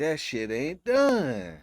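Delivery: slow, loud and disgusted. Real, not acted. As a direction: drag it out in loud disbelief. Disgusted at undercooked fish.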